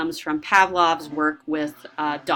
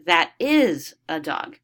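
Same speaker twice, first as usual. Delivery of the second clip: slow, stress on "is"; correcting parent; 2 semitones lower, 4 dB louder, slower than usual. Retold or imitated. Parent making the child repeat, slow with "is" stressed. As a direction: model it slowly, leaning on "is".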